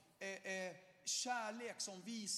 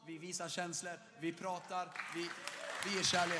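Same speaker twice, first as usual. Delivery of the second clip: from steady to loud preaching; building sermon; 9 dB louder than usual. Acted. Preacher to congregation about God's family, rising fervour.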